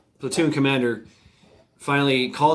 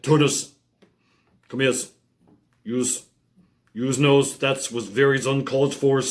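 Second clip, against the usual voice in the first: lightly imitated Puerto Rican accent with S on word endings; a hint of Puerto Rican accent, hissed word-final S; imitated Puerto Rican sergeant. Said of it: "at the end was a S".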